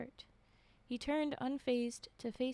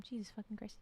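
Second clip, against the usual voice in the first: ordinary delivery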